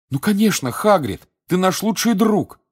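high, loud eager exclamation; exclaiming. A narrator's reading. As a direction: blurt it out warmly. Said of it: "воскликнул Гарри". (восклицая)

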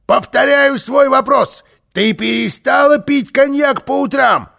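high, loud, insistent repeat; triumphant interrogation. Acted. Karlsson pressing the housekeeper, loud and insistent. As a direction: proclaim the question like a prosecutor.

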